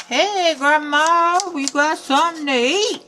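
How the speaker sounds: a lumberjack barging in with food; lightly voiced lumberjack; lumberjack calling. A narrator's reading. Lumberjack bursts in.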